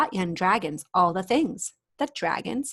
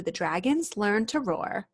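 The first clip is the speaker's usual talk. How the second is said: plain reading voice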